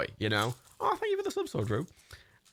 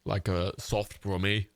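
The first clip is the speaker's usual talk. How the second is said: slow stab at a soft Brummie accent; 6 semitones lower, slower than usual. Shown as a demonstration. Coaching himself on the accent, slow soft Brummie attempt. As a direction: ease slowly into a gentle Brummie. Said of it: "You need to be softer".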